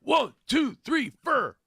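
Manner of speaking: high, loud band count-in saying "tour" as "ter"; mock rocker. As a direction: shout it like a hammed-up rock frontman.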